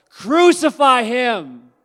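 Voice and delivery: high, loud, slow shout; crowd shout. Acted. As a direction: drag out the mob's demand.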